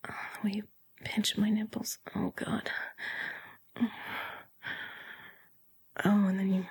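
breathy, gasping delivery with sighs; swooning, breath-heavy murmur; breathless arousal. Acted. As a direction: sigh between phrases, voice fading to whisper. Acted rising arousal in the story.

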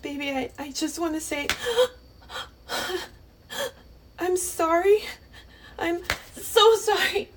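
crying, rising into a sob; sobbing sorry to her partner; tearful apology. Acted. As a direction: let the tears break through.

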